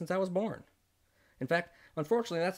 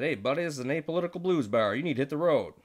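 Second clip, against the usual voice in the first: louder, firm brush-off; telling him off; 5 dB louder than usual. Retold or imitated. The narrator tells the taunting suit to leave, louder. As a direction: push him out of the bar, louder. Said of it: "I said".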